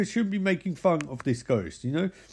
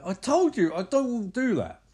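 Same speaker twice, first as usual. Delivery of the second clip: high-pitched imitated protest; mock exasperated refusal; 7 semitones higher than usual. Girlfriend refuses to be filmed in bed, mock exasperated.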